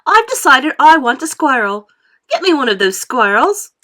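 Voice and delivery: loud, high bratty demand; spoilt demand. A narrator's reading. Spoilt girl demands a squirrel from Mummy, loud and bratty.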